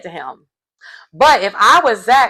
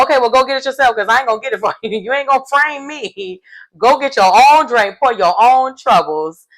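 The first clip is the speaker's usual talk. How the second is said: higher-pitched, emphatic mock refusal; sassy refusal; 5 semitones higher, faster than usual. Recapper voicing Ross refusing Paul a drink, sassy and high. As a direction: refuse indignantly, playing it up.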